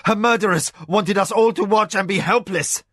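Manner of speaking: high, loud furious grief; anguished cry. A narrator's reading. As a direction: rage at the murderers. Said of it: "Hokanu cried, anguished".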